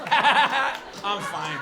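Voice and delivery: a touch of tipsy insistence; fake-steady drunk reassurance; drunk insisting. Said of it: "this period where you go".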